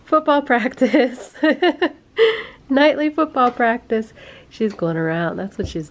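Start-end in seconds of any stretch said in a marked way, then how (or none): 0.1-4.1 amused. Real, not acted. Laughing at toddler crawling.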